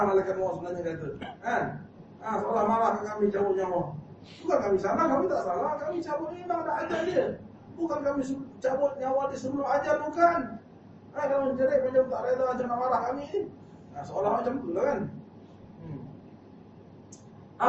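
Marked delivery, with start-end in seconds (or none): 4.5-12.8 high insistent denial. Acted. Angel insists.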